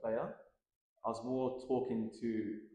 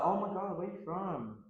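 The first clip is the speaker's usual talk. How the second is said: higher-pitched mock American voice; amazed American stranger, imitated; imitated American accent; 7 semitones higher than usual. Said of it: "Is that your American accent?".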